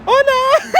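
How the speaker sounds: put-on very high chirpy toddler. Toddler looking up at grandpa, high chirpy voice. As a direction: greet like an oblivious tiny child. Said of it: "she's like".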